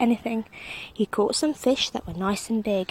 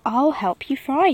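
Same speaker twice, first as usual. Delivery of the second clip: a hint of a bold king, louder; helpful king; 4 semitones higher, 7 dB louder than usual. A narrator's reading. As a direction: offer help with gusto. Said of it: "said the hungry king".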